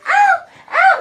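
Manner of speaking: two loud, very high yelps of pain; mock yelps. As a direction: squeal as if hit, imitating. Cousin crying out when hit.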